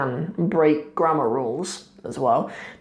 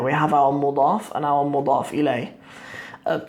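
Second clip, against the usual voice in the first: ordinary delivery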